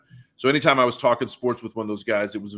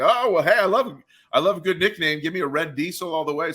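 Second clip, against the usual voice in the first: higher and louder, delighted at the nickname; excited reply; 6 semitones higher, 4 dB louder than usual. Retold or imitated. To the punter at the bar, a delighted, excited reply. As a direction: jump on the joke with glee.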